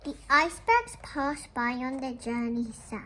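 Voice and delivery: gentle, measured read-aloud voice; storybook reading. Acted. Teacher reading a picture book to the class, gentle storytelling.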